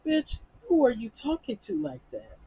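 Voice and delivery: pissed off. Retold or imitated. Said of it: "She had me pissed".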